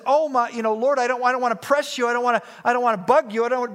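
a frightened servant rushing his plea to the Lord; panicky servant. Retold or imitated. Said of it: "his servant is freaking out".

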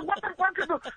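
high, stunned cry of disbelief; shocked disbelief. Retold or imitated. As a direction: blurt it out, stunned by what you see. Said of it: "I'm like".

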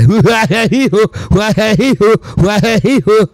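high, giggly fake laughter; a string of squeaky mock giggles; mock stoner laugh. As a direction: ham up the dumb party laugh. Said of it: "that's how they laugh out here".

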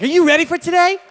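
very high, loud cheerleader call; excited wife. Retold or imitated. Pastor voicing his wife cheering him, shrieking. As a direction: cheer him on like a pep rally.

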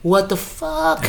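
slow, high, dumbfounded exclamation; stunned disbelief. Acted. Viewer shocked by an expected death, stunned disbelief. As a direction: draw each word out in hushed shock. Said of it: "I'm still like".

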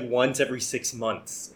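ordinary talk

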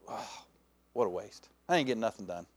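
sighed, low and soft; mild self-reproach, voice sinking; acted guilty regret. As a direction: let out a deflated "oh". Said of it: "have that same feeling about your life".

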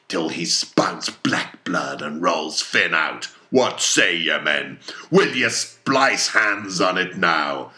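slow, forceful, mounting call; rousing rally. Acted. Ahab asks his men to splice hands on it, rousing. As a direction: build to a loud challenge.

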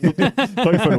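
laughing while echoing the German word Teufel. Real, not acted. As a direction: echo Teufel through a real laugh. Repeats German joke word laughing.

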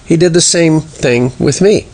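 usual speaking voice

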